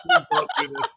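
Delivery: high-pitched pleading through amusement; mild mock panic. Best friend begging him to stop in public, mock panic. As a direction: imitate a panicked plea. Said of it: "She actually started panicking".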